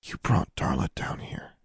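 soft, whispered murmur; hushed, barely voiced remark. A narrator's reading. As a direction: say it under your breath. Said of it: "Alexandra murmured".

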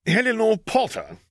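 high-pitched disbelieving question; almost incredulous. A narrator's reading. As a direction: call it up as if you can't quite believe it. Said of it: "he asked, sounding almost incredulous".

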